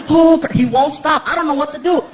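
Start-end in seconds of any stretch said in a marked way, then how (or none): 0.1-0.5 high, loud rhythmic chant; child throwing a fit. Acted. Son throws a fit in the kitchen, loud chant. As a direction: chant it like a tantruming kid. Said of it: "He throws a fit in the kitchen".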